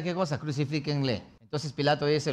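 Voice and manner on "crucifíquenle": ordinary delivery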